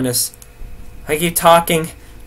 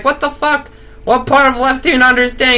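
mock irritation; 8 semitones higher, 4 dB louder than usual. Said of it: "be like".

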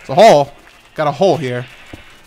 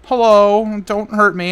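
mock timid; 6 semitones higher, 4 dB louder, much slower than usual. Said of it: "and I'm like".

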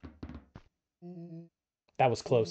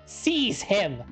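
shouting; 7 semitones higher than usual. Acted. Villain ordering guards.

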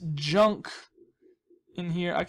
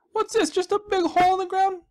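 very high, louder goofy voice; silly high voice; 12 semitones higher, 7 dB louder than usual. Pretend. Finding a hole in the ground, very high loud goofy voice.

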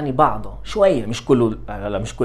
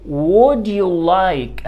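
stretched out, louder; very slow model phrase; 4 semitones higher, 5 dB louder, much slower than usual. Shown as a demonstration. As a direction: pull every word long and clear. Teacher models question, stretched. (عبارة ممدودة جدًا)

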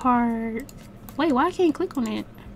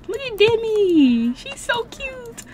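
cooing; 11 semitones higher, slower than usual. Real, not acted. Player admiring a child in her game, cooing. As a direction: fawn over a cute little one, drawn out.

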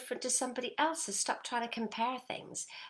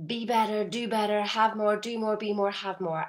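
louder, driving list of demands; mock pushing list; 6 dB louder than usual. Coach mimicking striving listeners, louder and driven. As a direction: push through each demand harder.

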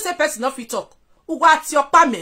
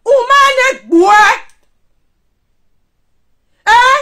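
high, loud, slow shout; shouted taunt; 8 semitones higher, 7 dB louder, slower than usual. Real, not acted. Taunting a rival on live video, shouted slow and high. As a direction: yell the name out.

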